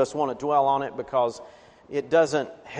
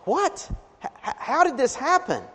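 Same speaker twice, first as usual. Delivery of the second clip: high, stammering disbelief; shocked parents; 8 semitones higher than usual. Retold or imitated. Upset parents hearing the son moved in, high shocked stammer. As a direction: gasp out the question in shock. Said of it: "They're like".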